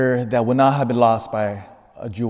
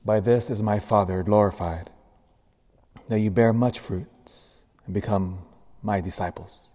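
gospel reading; 2 semitones lower than usual. Acted.

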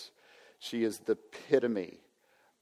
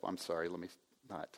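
choked up; 4 semitones lower, 11 dB quieter, faster than usual. Real, not acted. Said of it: "I'm gonna get choked up".

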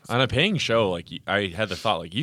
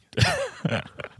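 high squeaky giggle; squealing laugh. Real, not acted.

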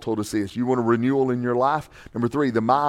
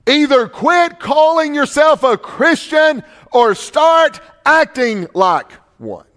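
slow, high pulpit shout; shouted challenge; 12 semitones higher, 6 dB louder, slower than usual. Real, not acted. Pastor daring listeners to live like Christians, slow shout. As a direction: yell the ultimatum at the pews.

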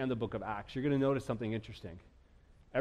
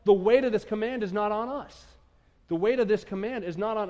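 louder, higher declaration of "The weight of this command is not on us"; emphatic proclamation; 7 semitones higher, 5 dB louder than usual. Shown as a demonstration. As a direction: ring it out to the whole church. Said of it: "Guess what?".

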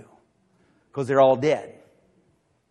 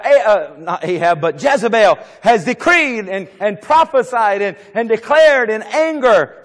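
ordinary delivery

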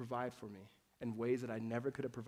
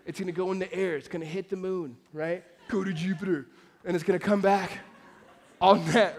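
put-on a boastful kid, getting louder toward the end; cocky junior high boy; 5 semitones higher, 9 dB louder than usual. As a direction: brag like a teenager about an impossible shot. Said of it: "junior high boys like".

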